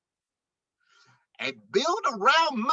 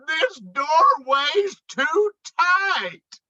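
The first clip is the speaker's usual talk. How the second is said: whining, stuck; 8 semitones higher, 3 dB louder, slower than usual. Acted. Moose stuck in the door.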